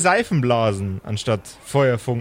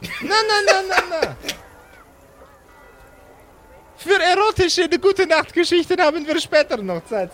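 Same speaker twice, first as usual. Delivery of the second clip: much higher, sneering rival voice; smug rival. Acted. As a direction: taunt him in a high mocking voice. Rival mocks Slotan. (hohe, höhnische Stimme des nervigen Konkurrenten)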